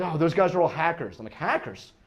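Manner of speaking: ordinary talk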